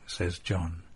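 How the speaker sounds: ordinary delivery, nothing marked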